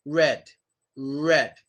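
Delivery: "red" drawn out on rounded lips; protruded-lip R. Shown as a demonstration. Teacher showing R at word beginning, lips protruded. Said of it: "we protrude our lips".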